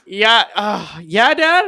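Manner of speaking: high, loud, put-upon answer; hammed-up weary kid, pitch climbing; reluctant kid reply. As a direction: answer Dad with exaggerated reluctance. Acted kid called back by dad.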